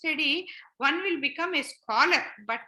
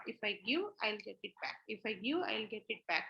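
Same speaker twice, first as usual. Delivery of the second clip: much softer, lower voice, as if thinking; private inner thought; 5 semitones lower, 10 dB quieter than usual. Acted. Giver thinking privately about the return, hushed aside. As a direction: say it low, like a secret calculation. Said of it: "we have this thought".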